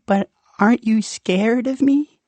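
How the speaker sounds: high, timid monster voice; a shy little creature asking, high. Acted. Rumpel asks the child, high shy creature voice.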